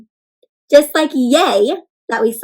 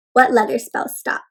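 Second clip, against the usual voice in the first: ordinary talk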